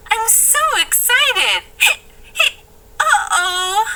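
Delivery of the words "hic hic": hiccups. Acted.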